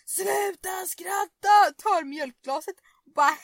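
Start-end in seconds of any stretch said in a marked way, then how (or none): 0.1-1.7 mock shout, higher, louder and slow; imitated yell. Brother yelling at his laughing sister, louder mock shout. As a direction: bellow it like the angry brother. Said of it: "Och han bara".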